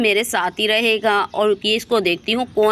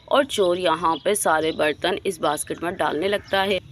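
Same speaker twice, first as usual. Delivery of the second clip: ordinary talk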